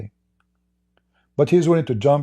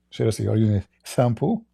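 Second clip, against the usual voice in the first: normal talking voice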